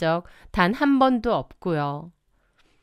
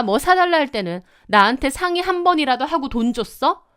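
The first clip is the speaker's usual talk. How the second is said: higher, fast indignant comeback; heated retort; 3 semitones higher than usual. Acted. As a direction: snap back, pitch climbing with outrage. Wife fighting back in quarrel.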